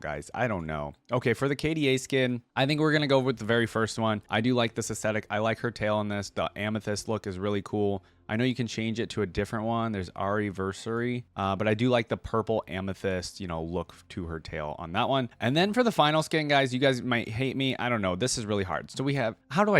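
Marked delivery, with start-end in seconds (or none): none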